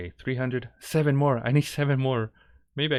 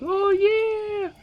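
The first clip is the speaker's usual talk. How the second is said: very high, louder excited squeak; thrilled squeal; 6 dB louder than usual. Retold or imitated. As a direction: squeal it like a delighted little kid. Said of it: "And I'm like".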